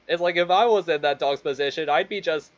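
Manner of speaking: ordinary delivery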